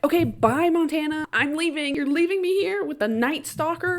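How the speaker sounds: high, loud hurried farewell; panicked goodbye. Acted. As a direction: bail out in a rush, voice up. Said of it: "Ray is like".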